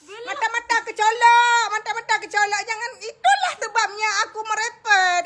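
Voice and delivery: high, whiny complaint; pained whining. Real, not acted. Woman poked in the eye by a lash, pained whining.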